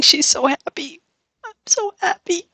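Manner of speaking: very high, tearful squeal; squealing joy. Real, not acted. Gamer watching the happy villager run away, squealing.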